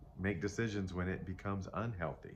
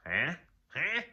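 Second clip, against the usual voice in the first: odd questioning voice; 7 semitones higher, 3 dB louder than usual. Real, not acted. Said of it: "I don't know why I'm talking like that".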